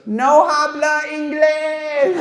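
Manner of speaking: mock Spanish-accent reply. Old wife dodging the question, in a mock Spanish accent. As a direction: blurt it in a hammed-up Spanish accent.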